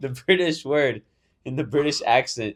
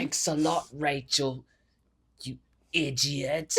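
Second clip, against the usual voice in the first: put-on British accent; 5 dB quieter, slower than usual. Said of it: "with their accent".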